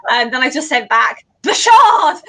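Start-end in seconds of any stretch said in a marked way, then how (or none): 1.4-2.2 louder, higher mock yell; capital letters. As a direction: say it as if typed in all caps. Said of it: "in capital letters".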